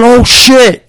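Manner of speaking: high, loud alarm; panicked curse. Retold or imitated. Parent seeing the baby fall, high loud panic. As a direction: blurt it out in fright.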